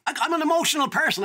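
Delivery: normal talking voice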